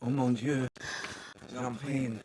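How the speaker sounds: lightly put-on French accent; a hint of a French chef. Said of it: "Let's take break into French".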